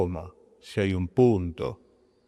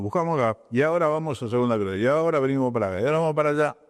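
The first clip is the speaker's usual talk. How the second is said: rushed page flipping; 3 semitones higher, much faster than usual. Shown as a demonstration. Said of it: "Un momento que la gente hace así".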